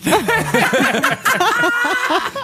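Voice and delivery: a burst of high-pitched laughter. Real, not acted. Telling the funny story to co-hosts, laughing. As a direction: crack up laughing.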